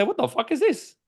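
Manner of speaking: high, incredulous retort to his own doubt; mock disbelief. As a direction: scoff at the thought like it's absurd. Mocking his own doubting thought.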